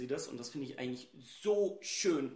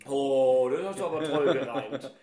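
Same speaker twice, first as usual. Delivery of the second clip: laughing mock praise; 10 dB louder than usual. Real, not acted. Teasing praise of a rhyme.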